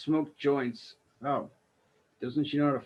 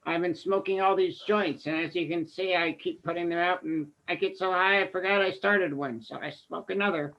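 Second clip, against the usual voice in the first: higher, dazed stoner voice; 4 semitones higher than usual. Pretend. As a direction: ramble like a stoned lady caught by a cop. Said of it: "I'm a little stoned right now".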